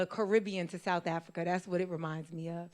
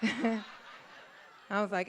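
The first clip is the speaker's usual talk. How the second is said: normal talking voice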